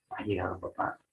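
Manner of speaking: Ha llegado papá softly, as a question ending on papá; soft yes-no question, lift papá; Ha llegado papá with a quiet ascending tune. Shown as a demonstration. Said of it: "es un tono ascendente".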